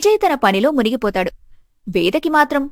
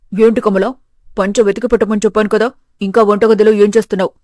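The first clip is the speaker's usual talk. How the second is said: father-in-law, impatient; 2 semitones lower, 3 dB louder than usual. Acted.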